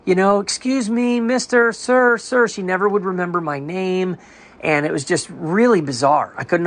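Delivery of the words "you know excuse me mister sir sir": higher, calling across a fence to get his attention; mock neighbor hollering for him; imitating neighbor calling out. Neighbor yelling over the fence at him, imitated higher call-out. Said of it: "She starts yelling over her fence at me".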